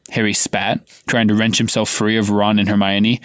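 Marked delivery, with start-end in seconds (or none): none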